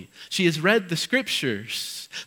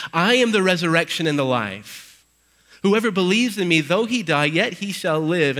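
loud, ringing proclamation of Jesus' words; preaching at full force; 3 semitones higher, 5 dB louder than usual. Acted. Pastor proclaiming Jesus' words to the church, loud and ringing. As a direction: proclaim it to the congregation with power.

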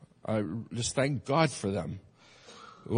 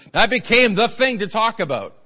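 higher and louder, punching became; emphatic loud restart; 8 semitones higher, 9 dB louder than usual. Acted. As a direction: hit the repeat with a shout.

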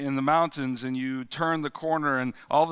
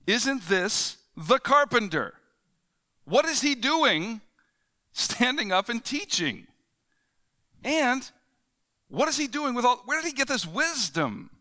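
incredulous townsfolk; 8 semitones higher than usual. Retold or imitated.

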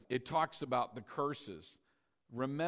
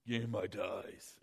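lower, menacing Godfather growl; mafia boss; 6 semitones lower than usual. Retold or imitated. Preacher mocking God as a mafia boss demanding tithes, low menacing voice. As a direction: play a gangster demanding payment. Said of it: "like a mafia boss".

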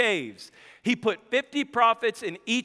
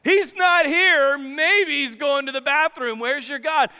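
hammed-up mocking; 5 semitones higher, 5 dB louder than usual. Elijah mocking the prophets over their absent god: hammed-up taunt. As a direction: ham up a jeering prophet. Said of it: "Elijah starts mocking them".

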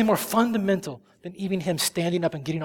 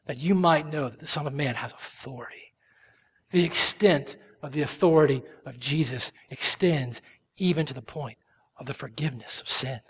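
ordinary delivery

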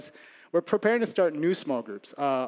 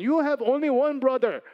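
put-on dad's broken English with a Korean accent, pitched higher; a father exclaiming to his son in a Korean accent; 9 semitones higher, 4 dB louder than usual. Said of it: "in his broken English, his Korean accent".

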